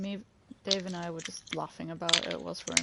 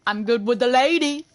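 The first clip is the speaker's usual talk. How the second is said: smug boast; 5 semitones higher, 9 dB louder than usual. Pretend. Smug boast after trophy.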